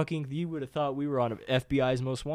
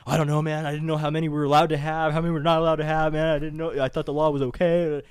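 higher, louder flustered excuses; panicky Mike; 4 semitones higher, 8 dB louder than usual. Retold or imitated. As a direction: plead ignorance in a loud, raised voice. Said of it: "And Mike's like".

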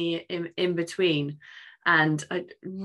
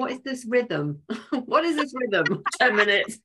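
laughing; 6 semitones higher, 4 dB louder than usual. Real, not acted. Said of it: "That's so funny".

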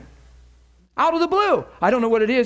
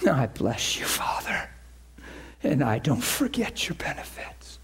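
low hushed whisper; whispered prayer; 8 semitones lower, 7 dB quieter than usual. Retold or imitated. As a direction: pray it under your breath.

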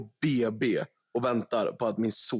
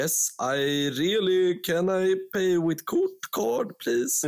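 slow, higher Swedish English, overdone; Swedish English accent; 6 semitones higher, much slower than usual. Retold or imitated. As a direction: play the stiff Swede ordering in English. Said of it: "Ska man komma dit och bara säga".

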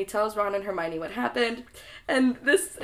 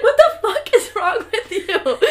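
high, loud, hammed-up outrage; freaked-out yell; 12 semitones higher, 8 dB louder than usual. Ron to screaming Harry in the dormitory, freaked-out yelling. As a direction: holler it near the top of your voice.

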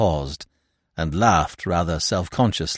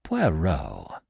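understated horror, falling and soft; a drawn, hushed mild protest; horrified; 5 semitones lower, 6 dB quieter than usual. Acted. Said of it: "I cried, horrified".

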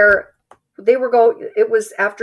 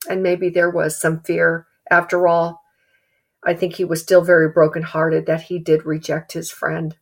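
ordinary talk, nothing marked

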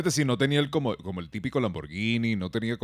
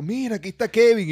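much higher, louder yelled call; mock shout; 13 semitones higher, 9 dB louder than usual. Protester calling out Kevin, shouting high. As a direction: yell it across the street. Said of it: "empezó a pegar gritos".

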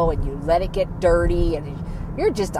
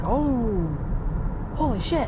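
higher, hushed stunned "oh"; shocked reaction; 3 semitones higher, 5 dB quieter than usual. Retold or imitated. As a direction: gasp it out, startled. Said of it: "And I'm like".

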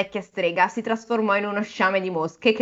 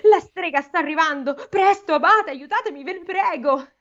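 high, urgent exclamation; breathless high begging; frantic novice plea; 8 semitones higher than usual. A narrator's reading. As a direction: beg for help in a panic. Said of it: "esclamò il novizio".

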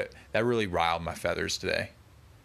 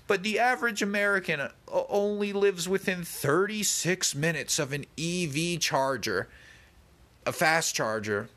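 higher mocking lecture voice; mocking the video host; 9 semitones higher than usual. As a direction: parrot the host's claim in a higher voice. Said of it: "He goes".

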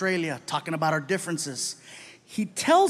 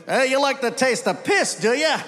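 sarcastic Aussie bartender; 7 semitones higher, 4 dB louder than usual. Retold or imitated. Bartender mocks the American.

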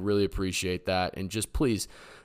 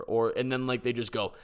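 normal talking voice

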